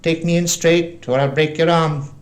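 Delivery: high, loud, cruel sneer; sneering threat. A narrator's reading. Blind man gripping boy's arm, high sneering threat. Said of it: "he sneered".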